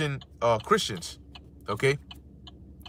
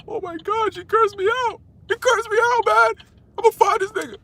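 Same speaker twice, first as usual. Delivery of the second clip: high, whiny put-on voice of an offended man; whiny mock victim; 4 dB louder than usual. Preacher mocking a hurt critic, high whiny overblown voice. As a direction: ham up a crybaby losing it over being cursed out.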